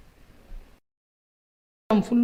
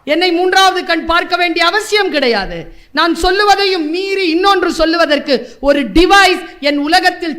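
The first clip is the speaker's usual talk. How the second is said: loud, high impassioned speech; full-force oratory; 4 semitones higher, 4 dB louder than usual. Real, not acted. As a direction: preach it to the hall. Orator raises voice. (உணர்ச்சிமிக்க மேடைப் பேச்சு)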